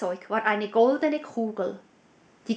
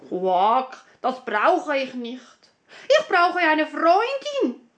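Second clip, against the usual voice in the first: the lonely frog asking for a friend; 3 semitones higher than usual. Acted. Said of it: "antwortete der Frosch". (hohe, langsame Froschstimme)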